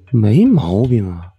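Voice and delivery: plain reading voice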